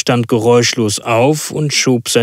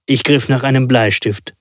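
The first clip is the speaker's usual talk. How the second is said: usual speaking voice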